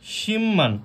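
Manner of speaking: high, falling শিম্মান with a double m; correct pronunciation. Shown as a demonstration. Teacher answering own question, correct assimilated শিম্মান. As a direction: say শিম্মান as "shim-man", the b becoming m. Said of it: "উচ্চারিত কি হবে".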